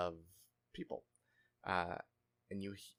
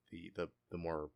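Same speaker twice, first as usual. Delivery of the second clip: ordinary talk, nothing marked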